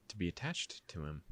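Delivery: usual speaking voice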